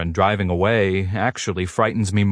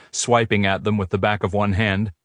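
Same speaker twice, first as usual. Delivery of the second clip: ordinary delivery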